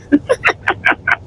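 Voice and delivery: quick unvoiced chuckle; short breathy laugh. Real, not acted. Laughs at own joke.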